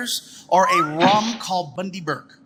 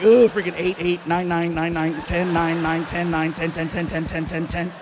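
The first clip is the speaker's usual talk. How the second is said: groan, then drone the tally lower and lower; grimly ticking off bad scores; grim self-scoring; 3 semitones lower than usual. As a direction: rattle off numbers in a dull monotone. Muttering rising scores while filling form.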